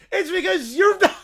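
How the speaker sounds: mock him through laughter; laughing taunt. Bear taunting rival in a quarrel.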